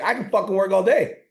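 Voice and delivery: high and loud, all fired up; mock pumped-up. Voicing his eager mind, high and loud. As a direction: brag like the mind can't be stopped.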